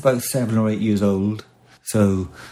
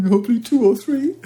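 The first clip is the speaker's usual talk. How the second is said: laughing. Real, not acted. Joking about launch party guests.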